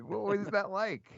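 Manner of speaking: usual speaking voice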